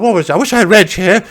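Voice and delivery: ordinary delivery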